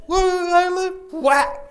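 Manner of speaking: very high, loud mock wail; crying woman. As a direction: howl it like the heartbroken woman. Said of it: "let her get in a couple of more whacks".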